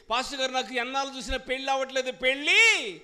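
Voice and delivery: acted pleading, building high and loud; loud demanding plea. Believer demanding marriage from pastor, loud plea. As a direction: press the complaint, ending in a cry. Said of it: "గట్టిగా ప్రార్థన చేయించుకుంటాం".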